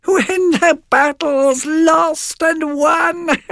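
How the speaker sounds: very high, slow witch shriek. Acted. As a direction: screech it out gleefully. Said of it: "laughed the second".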